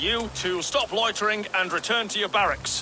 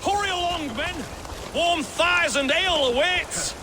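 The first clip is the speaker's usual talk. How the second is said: high-pitched, hurrying call; calling out to men; 10 semitones higher than usual. Acted. Fake thane rallying his men, high hurrying call. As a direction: sing it out high to chivvy them along.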